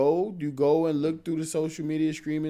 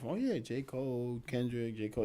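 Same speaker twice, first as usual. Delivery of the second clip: sing-songy; 11 dB quieter than usual. Shown as a demonstration. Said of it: "in a sing-songy pattern".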